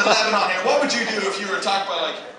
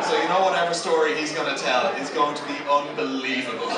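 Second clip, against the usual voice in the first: ordinary talk, nothing marked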